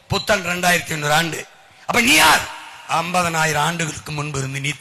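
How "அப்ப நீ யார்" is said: high, loud shouted question; rousing shout. Real, not acted. Orator asking the crowd who they really are, shouting.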